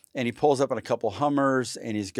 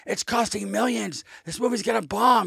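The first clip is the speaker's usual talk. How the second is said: studio yelling; 11 semitones higher than usual. Retold or imitated. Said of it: "Twentieth Century Fox is yelling at me".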